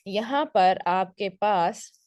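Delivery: ordinary talk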